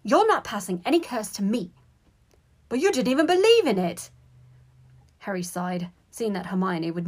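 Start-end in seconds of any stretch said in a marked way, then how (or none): 0.0-1.7 raised, higher-pitched protest; indignant, louder objection; indignant cry. A narrator's reading. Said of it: "cried Hermione". 2.7-4.1 high, loud objection; exasperated, raised-voice protest. A narrator's reading. Harry protests Hermione's refusal.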